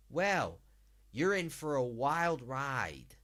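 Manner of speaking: put-on 1 American cowboy accent. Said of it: "I'll give it a shot".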